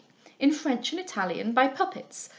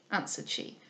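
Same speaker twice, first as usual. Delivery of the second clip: usual speaking voice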